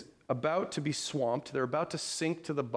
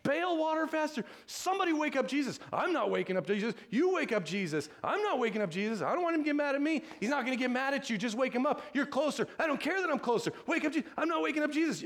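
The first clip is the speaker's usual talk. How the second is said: much higher, hurried voices of frightened fishermen bickering; panicked disciples quarrelling; 12 semitones higher, faster than usual. Retold or imitated. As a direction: play the scared disciples squabbling over who wakes Jesus. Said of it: "they're screaming at each other".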